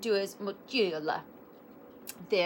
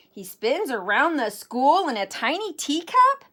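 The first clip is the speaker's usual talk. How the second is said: high, louder incredulous question; shocked exclamation; 5 semitones higher, 5 dB louder than usual. A narrator's reading. Kitty boy hearing about the bully, shocked. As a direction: exclaim it in disbelief. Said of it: "exclaimed the kitty boy in shock".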